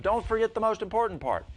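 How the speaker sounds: high, loud reminder across the room; calling after someone. Retold or imitated. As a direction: call it out to someone heading for the door. Calling after departing customer.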